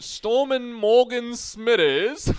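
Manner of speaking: loud, high, drawn-out mock Irish cry; put-on Irish accent. Co-host gleefully announcing a favourite player, mock Irish accent. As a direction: belt out the name slowly in a fake Irish accent. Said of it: "doing an Irish accent".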